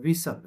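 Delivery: વિસલ with no T, S straight into L; silent T. Shown as a demonstration. Teacher showing students how whistle is spoken, silent T. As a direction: say વિસલ, leaving out the T. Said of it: "આને શું બોલાશે?".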